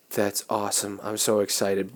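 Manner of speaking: soft, contained excitement; keeping it calm. Retold or imitated. New priest to the bishop at his assignment, calm and soft. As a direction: hold the thrill down, quietly. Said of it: "trying to keep it, like, calm with the bishop".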